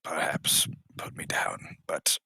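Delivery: whispered plea, no voice; hushed request to put him down. Acted. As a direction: breathe it to the big ogre. Marine quietly asks ogre.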